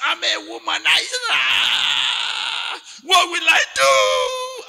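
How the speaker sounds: high, loud wailing confession, breaking into sobs; crying confession. Retold or imitated. Retelling a weeping man crying out his confession to the church. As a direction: shout it out like a man confessing in tears.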